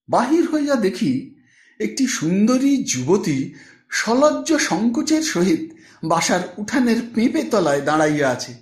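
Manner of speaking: ordinary delivery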